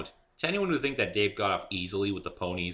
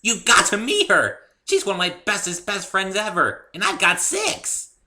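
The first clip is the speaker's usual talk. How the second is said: very high, loud, giddy voice; gushing party pony; 13 semitones higher, 11 dB louder than usual. A narrator's reading. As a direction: gush about your best friends. Pinkie gushes about friends.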